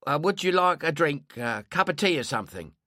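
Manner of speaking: a hint of a chatty old woman; lightly voiced neighbour, offering tea; old London landlady voice.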